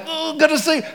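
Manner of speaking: put-on squeaky high, kid who can't sleep; excited child. Little kid on Christmas Eve answering parents, giddy high squeal. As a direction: chirp it like a thrilled little kid.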